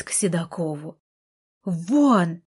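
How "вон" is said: one loud barked word. Acted. Heroine pushing Artur's wheelchair out, loud bark. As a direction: bark him out of the room. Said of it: "рявкнула".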